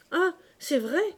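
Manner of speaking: high, loud cry of recalling; sudden exclamation. A narrator's reading. Mother suddenly remembering the notebooks, exclaiming. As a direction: exclaim as it comes back to her. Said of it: "s'écria la Normande".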